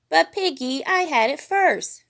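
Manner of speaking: put-on childish high protest; Fozzie protesting. A narrator's reading. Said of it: "Baby Fozzie protested".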